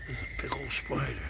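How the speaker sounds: hushed whisper. Real, not acted. Man watching a big spider whispers in surprise. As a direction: whisper so the critters aren't disturbed.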